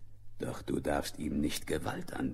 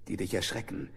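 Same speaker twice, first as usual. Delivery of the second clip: ordinary talk, nothing marked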